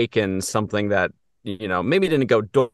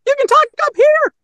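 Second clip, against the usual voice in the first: very high, louder falsetto; 9 dB louder than usual. Shown as a demonstration. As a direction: push into a squeaky falsetto. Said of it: "with a falsetto".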